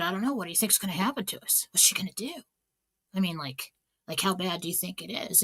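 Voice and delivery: frightened siblings murmuring so mom won't hear; scared kids whispering. Retold or imitated. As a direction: kids huddled on the bed, hushed and worried.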